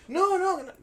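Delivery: high, loud repeated refusal; retold agitated cry; frantic protest. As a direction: shout it mid-scuffle. Struggle while stopping fight.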